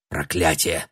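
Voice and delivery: low, soft curse; muttered curse. A narrator's reading. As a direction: swear under your breath. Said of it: "выругался Шенди".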